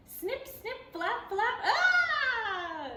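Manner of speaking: high flapping sounds ending in a laughing cry; high bat noises. A narrator's reading. Storyteller voicing a bat in the barber shop.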